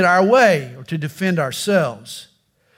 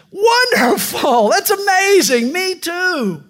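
high, drawn-out joyful cry, much higher; overjoyed; 11 semitones higher, slower than usual. Acted. Rescuer overjoyed at another match, high slow gushing. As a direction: gush with delight, slowly.

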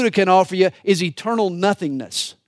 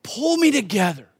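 David's fervent prayer; 6 semitones higher than usual. Acted. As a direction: voice David begging God for control. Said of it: "In essence, he's praying".

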